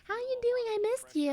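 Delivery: very high, cutesy greeting; acted high-pitched sweetness; sweet grandchild voice. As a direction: pipe up like a doting little grandchild. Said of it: "That's not how I talk to her, but, like, I'm like".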